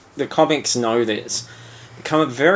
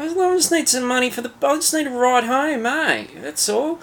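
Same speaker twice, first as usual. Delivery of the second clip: put-on much higher, fast, whiny Australian accent; pleading Australian Skrull; 11 semitones higher than usual. Cohost voices the Skrull begging a ride home, high Australian whine. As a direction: beg for bus fare like a down-and-out Aussie. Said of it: "a hundred percent Australian accent".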